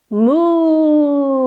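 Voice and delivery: lowing moo like a cow; yoga teacher mooing for kids in cow pose. Pretend. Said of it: "for cow".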